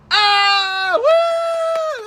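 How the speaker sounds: high, loud cheering shout; crowd cheer. Retold or imitated. Fan imitating a theatre crowd cheering, high loud whoop. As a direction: whoop like a whole cinema crowd erupting. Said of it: "everyone goes".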